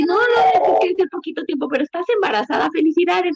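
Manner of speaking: high, hurried imitation of an excited cousin; excited congratulations. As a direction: act out gushing reassurance, pitched well up. Cousin reassures and congratulates, acted out.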